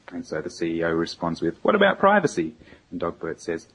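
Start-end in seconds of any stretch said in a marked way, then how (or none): 1.6-2.5 worried CEO. Retold or imitated. CEO quoted in Dilbert cartoon.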